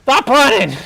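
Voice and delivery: high, loud command; exasperated shout. Real, not acted. Gamer calling after fleeing teammates, exasperated shout. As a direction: yell after someone running away.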